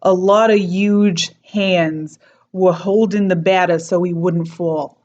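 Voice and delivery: slow New York accent, H dropped in huge; New York accent, dropping the H in huge. Shown as a demonstration. New York accent, teacher's corrected key sentence retake. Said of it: "my key sentence is".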